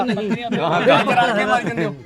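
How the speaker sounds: laughing. Real, not acted. Teasing during livestock haggling.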